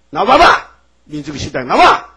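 loud, furious summons; God's angry shout. Retold or imitated. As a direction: call them out in a rage. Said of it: "하나님 막 화가 나서". (화난 하나님의 호통)

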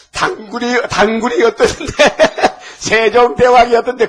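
high, loud mimicry cracking up with laughter; loud, giggling mock retort; laughing mock backtalk. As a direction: imitate a cheeky kid while laughing. Child's absurd backtalk, cracking himself up.

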